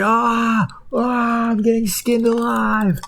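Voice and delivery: very high mock screams of pain; screaming potato. Potato is being peeled, very high panicked screams. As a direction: shriek like being skinned alive. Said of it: "he's just like".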